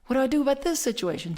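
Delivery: high, quick, panicky question; retold frantic asking, rushed; scared to death. New minister pressing the teacher for help, frantic. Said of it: "I was scared to death".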